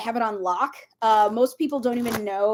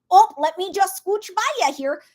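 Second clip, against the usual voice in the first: higher, louder Minnesota-nice squeeze-past; Minnesotan politeness; 7 semitones higher, 5 dB louder than usual. Shown as a demonstration. Minnesotan squeezing past somebody, folksy polite singsong. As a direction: chirp a folksy Midwestern excuse-me. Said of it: "Minnesota passive aggressive".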